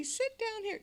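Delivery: sweet welcome. Retold or imitated. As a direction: coax a newcomer kindly. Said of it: "you say".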